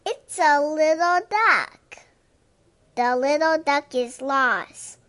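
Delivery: usual speaking voice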